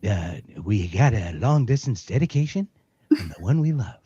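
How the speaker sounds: a smooth DJ dedication read, lightly done; Casey Kasem impression. Asked by the guest, he does a light Casey Kasem radio voice. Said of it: "do a Casey Kasem for me".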